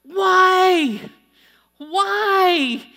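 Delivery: high, loud furious yell; shouting coach who can't believe it; coach screaming. Retold or imitated. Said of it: "the screaming began".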